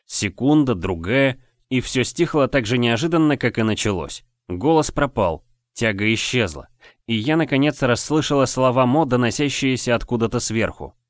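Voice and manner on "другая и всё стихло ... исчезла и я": ordinary delivery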